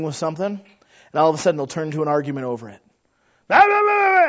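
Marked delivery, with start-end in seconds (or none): 3.5-4.3 put-on high squeaky kid squabble, louder; shrill little brothers fighting, mock; bickering kids. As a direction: mimic two children arguing in gibberish. Imitating kids arguing.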